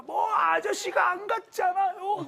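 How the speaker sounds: high, drawn-out indignant protest; mock quarrelling. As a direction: argue back like the other driver, hammed up. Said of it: "막 이렇게 싸우잖아요". (억울한 듯 맞받아치는 싸움 흉내)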